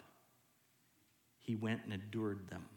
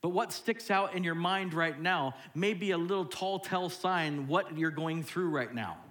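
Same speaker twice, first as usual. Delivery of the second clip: ordinary talk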